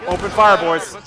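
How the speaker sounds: game commander rallying the troops; battlefield commands. Pretend. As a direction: call orders down the battle line. Calls battle orders to his troops.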